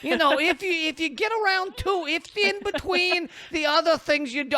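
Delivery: a meek, sorrowful rabbi asking very politely; sad rabbi voice. Pretend. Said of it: "that's a sad rabbi voice".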